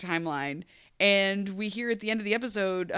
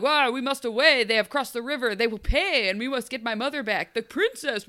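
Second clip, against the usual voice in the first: louder at first, grand knightly declaration; mock heroic prince; 3 semitones higher, 4 dB louder than usual. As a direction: ham it up like a stage knight. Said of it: "on a horse being like".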